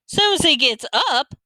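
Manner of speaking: high, slow, dismayed Southern question; upset Southern echo. A narrator's reading. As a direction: echo it back in drawn-out Southern disbelief. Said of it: "Mama sounded real upset and disappointed and Southern".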